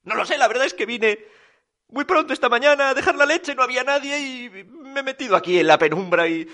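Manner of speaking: sheepish milkman. A narrator's reading. Milkman explaining why he hid in the dark, high rambling excuse. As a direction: run on lightly through the explanation.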